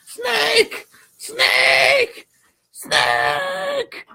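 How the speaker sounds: very high shouted cry; uncanny impression. Host mimics a desperate call, uncanny impression. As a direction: call the name out high, stretching each one. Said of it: "That was an uncanny impression".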